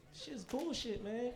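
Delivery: ordinary talk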